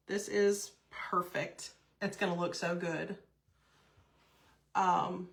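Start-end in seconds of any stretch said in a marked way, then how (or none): none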